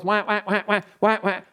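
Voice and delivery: same-tone duck call quacks, high and even; duck call honks. Shown as a demonstration. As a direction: honk out a flat repeated duck call. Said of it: "They'll go like".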